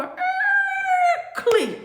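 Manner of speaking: screech, then high loud click; vocal sound effect of latching metal; imitated door noise. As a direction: mimic a cell door squealing shut. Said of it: "as they close the door".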